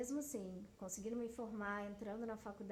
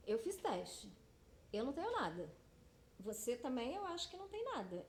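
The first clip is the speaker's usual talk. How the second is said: mock-confident assurance, rising high toward the end; imitated assurance; 5 semitones higher than usual. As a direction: imitate a partner brushing off the risk, pitch climbing. Voices partner dismissing the risk.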